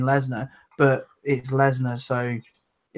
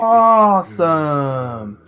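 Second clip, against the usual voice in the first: drawn-out awesome; much slower than usual. Pretend. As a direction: stretch awesome out very slowly.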